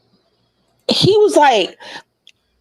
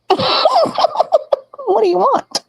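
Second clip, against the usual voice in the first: high, very slow, laughing through the question; laughing retort; 12 semitones higher, much slower than usual. Retold or imitated. Laughing at the ex begging her into his car. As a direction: drag it out while laughing at him.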